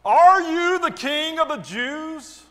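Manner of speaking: loud, high, drawn-out challenge; questioning governor. A narrator's reading. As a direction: ring out the question like a ruler. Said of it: "the governor asked him".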